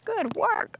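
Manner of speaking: Good work very high, gushing; squeaky high praise. Real, not acted.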